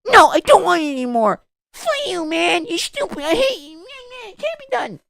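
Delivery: very high, hammed-up tantrum; mock a tearful child throwing a fit; whiny baby. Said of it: "be a whiny little baby".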